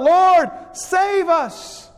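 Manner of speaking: very high, drawn-out plea; crying out. Acted. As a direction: call out slowly for rescue. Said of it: "cry out as well".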